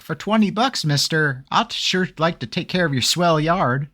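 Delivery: hammed-up golly-gee paperboy pitch; old-timey eager kid. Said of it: "Isn't that how they talk?".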